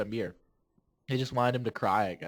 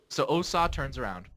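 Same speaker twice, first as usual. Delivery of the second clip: usual speaking voice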